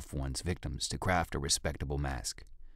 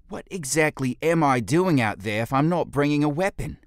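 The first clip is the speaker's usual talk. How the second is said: much higher, much louder disbelieving protest; indignant protest; 10 semitones higher, 9 dB louder than usual. Acted. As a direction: push back against the order. Fekis protests.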